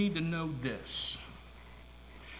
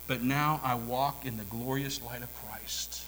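whisper; 2 semitones lower than usual. Acted. Shepherd whispering of the glorious light. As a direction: hush it like a confession. Shepherd testimony turns hushed.

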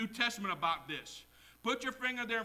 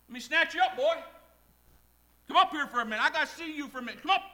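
calling out; 9 semitones higher, 9 dB louder, faster than usual. Acted. Playfully voicing the heavenly summons.

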